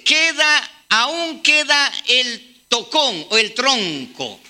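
slow, stretched-out reading voice; solemn scripture reading. Acted. Preacher reading the verse aloud, slow and solemn. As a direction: drag out "queda" as the promise.

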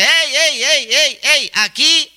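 high-pitched, attention-grabbing call as the dress; mock calling out. Preacher voices a clingy dress hailing the street, mock calling out. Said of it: "grita a voz en cuello".

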